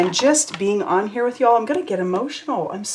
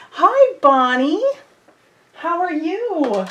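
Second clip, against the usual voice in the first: high, slow, louder sing-song hello; drawn-out high hi to Bonnie; excited greeting; 11 semitones higher, 5 dB louder, much slower than usual. Real, not acted. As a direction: stretch the hello out happily. Greeting a viewer in chat.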